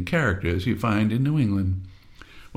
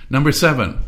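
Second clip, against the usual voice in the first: normal talking voice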